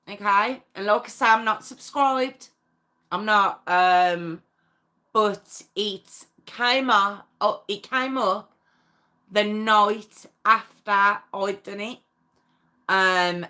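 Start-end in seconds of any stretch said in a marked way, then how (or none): none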